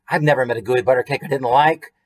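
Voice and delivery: ordinary delivery, nothing marked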